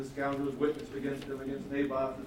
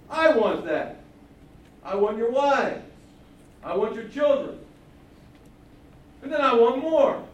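louder and higher with every demand; the enemy king listing his demands, growing louder; greedy demands; 8 semitones higher, 10 dB louder than usual. Acted. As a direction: bark out each want, building to more.

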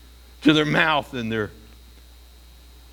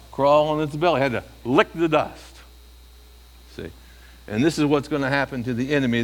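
normal talking voice

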